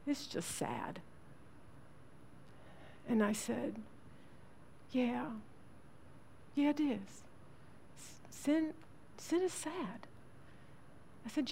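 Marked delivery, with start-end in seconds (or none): 0.1-1.0 put-on soft, low, sad child's murmur; a sorrowful boy at the kitchen table; sad little voice. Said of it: "he just sat there and he said". 4.9-10.1 soft, high, tender mom voice; mother consoling her weepy son; gentle comfort. Retold or imitated.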